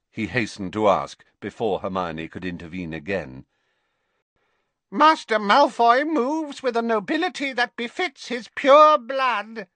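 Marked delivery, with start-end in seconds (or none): 4.9-9.7 higher, louder reverent elf croak; an old elf praising his young master. A narrator's reading. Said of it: "croaked Creature at once".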